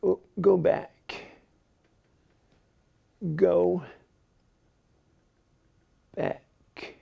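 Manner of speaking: slow, soft, fading Capote-like God; a lightly voiced Truman Capote sending him back; God whispering him back to life. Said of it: "sounds like Truman Capote".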